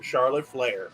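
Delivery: usual speaking voice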